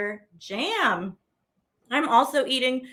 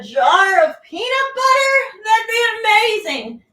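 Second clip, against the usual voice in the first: louder, higher, thrilled; delighted; 9 semitones higher, 8 dB louder than usual. Real, not acted. Delight imagining peanut butter jar.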